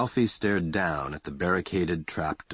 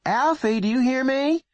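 calling up louder, higher; raised voice; 5 dB louder than usual. Acted. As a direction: raise your voice to be heard upstairs. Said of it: "She raised her voice".

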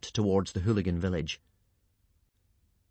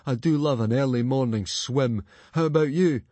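put-on croaky boy, louder at the end; mock-cheerful after swallowing seawater; dry, lightly croaked sarcasm; croaking; 4 semitones higher, 4 dB louder than usual. A narrator's reading. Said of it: "croaked Fishlegs, coughing up".

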